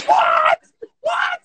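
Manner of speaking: very high, loud squealed "what"; piercing falsetto cry of astonishment; amazed shriek. Acted. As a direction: yelp in total disbelief. Reliving amazement at the step.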